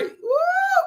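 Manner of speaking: high shouted woo; ecstatic whoop. Real, not acted. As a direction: let out a joyful preacher's whoop.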